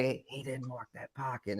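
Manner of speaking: very soft, low, secretive murmur; retold whisper. Player tipping off his teammate mid-game, whispering. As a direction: imitate a furtive aside, hushed. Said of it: "'cause I whispered to my teammate".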